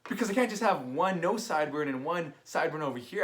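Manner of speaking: usual speaking voice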